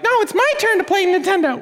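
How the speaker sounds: mock kid whine; whiny kid sibling. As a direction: play the squabbling kid demanding his turn. Squabbling kid voiced.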